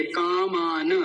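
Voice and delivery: "कामान" slow, with a voiced final na; full na. Shown as a demonstration. Teacher apologises and corrects himself, slow full na. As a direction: stretch "कामान" as kaa-maa-na. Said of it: "कामान नहीं है".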